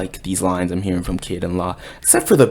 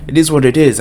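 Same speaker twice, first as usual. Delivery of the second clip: loud declaration; 4 semitones higher, 12 dB louder, faster than usual. Real, not acted. Hyped about the chapter.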